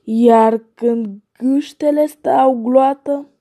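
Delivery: plain reading voice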